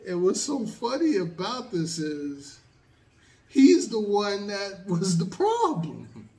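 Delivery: high, loud, laughing incredulity; loud, amused outburst, pitched right up; amused disbelief. Real, not acted. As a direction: burst out at the absurd irony, on the edge of laughter. Laughs at the story's irony.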